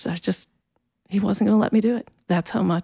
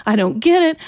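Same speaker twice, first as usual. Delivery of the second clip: higher-pitched, mildly angry outburst; retold angry yelling; 8 semitones higher than usual. Teenager to God on the stairs, retold anger. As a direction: re-enact railing at God without full volume. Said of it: "so I'm yelling".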